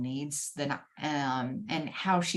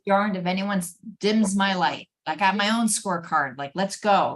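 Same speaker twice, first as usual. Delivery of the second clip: fired-up declaration; 5 semitones higher, 8 dB louder than usual. Real, not acted. Passionate declaration of mission.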